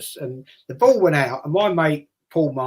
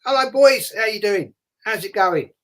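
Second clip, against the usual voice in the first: higher, louder friendly hello; lightly voiced warm hello to kids; cheery goalkeeper; 6 semitones higher, 4 dB louder than usual. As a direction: greet the boys like a big friendly keeper. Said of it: "He go".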